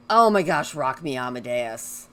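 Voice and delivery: loud burst of delight; excited exclamation. Real, not acted. Suddenly recalling Rock Me Amadeus, loud excited gasp. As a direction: blurt it out, thrilled. Remembers a favorite song.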